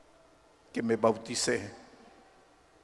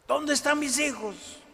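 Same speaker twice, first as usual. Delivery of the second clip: very high-pitched shouted question; angry demand; 13 semitones higher than usual. Acted. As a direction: play a violent father demanding his children. Acted violent father demanding again. (grito exigente y muy agudo)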